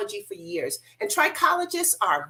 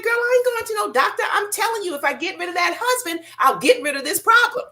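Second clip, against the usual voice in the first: put-on high-pitched, loud arguing girlfriend voice; arguing client; 10 semitones higher, 4 dB louder than usual. Client in the chair arguing with Debbie, high and loud. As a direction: push back hard, voice way up. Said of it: "she wanted to argue".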